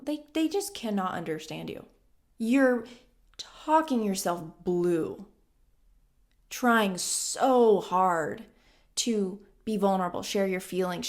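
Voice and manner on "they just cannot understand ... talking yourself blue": normal talking voice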